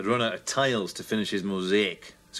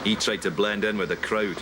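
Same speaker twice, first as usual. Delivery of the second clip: noir detective narration; 4 semitones lower than usual. Acted. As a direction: narrate like a hardboiled private eye.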